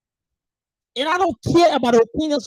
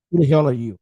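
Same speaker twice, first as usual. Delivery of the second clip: loud, angry challenge; angry demand; 8 semitones lower, 5 dB louder than usual. Real, not acted. Demanding angrily of the rude critics who they are. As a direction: bark the question at them.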